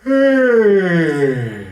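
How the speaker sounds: high, loud "Eh" sliding down like a sigh; yawned eh. Shown as a demonstration. Voice teacher leading a yawned eh sigh, high and loud. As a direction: sigh the eh out, relaxed. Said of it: "try that with an eh".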